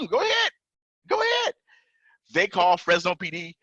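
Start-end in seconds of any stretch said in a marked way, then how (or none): 0.1-1.5 very high-pitched go-ahead; retold insistence. Cuffed man telling police to check his gun, insistent. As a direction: repeat it in a high voice, re-enacting the reply.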